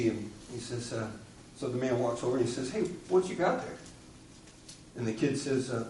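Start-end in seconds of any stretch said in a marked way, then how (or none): 2.7-3.8 put-on high, curious call to a kid; friendly call out. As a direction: sing out a friendly hello. Man calls to boy.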